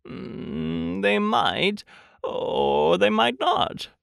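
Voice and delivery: slow, high musing with rising ends; doubtful storybook bear. Acted. Pooh considers whether bees will notice, slow and doubtful. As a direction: weigh it up, stretching the words.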